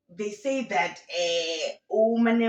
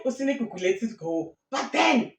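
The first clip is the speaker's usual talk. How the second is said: ordinary talk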